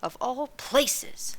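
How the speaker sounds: slow, drawn-out musing, lifted high; wondering aside. A narrator's reading. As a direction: marvel at it half to herself. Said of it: "in a wondering aside".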